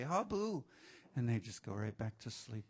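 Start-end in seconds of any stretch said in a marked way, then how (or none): 0.0-0.6 high, quiet sudden wake-up call; imitating a dozer chiming in; waking sleeper. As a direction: pipe up softly as if startled out of sleep. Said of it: "wake up and say".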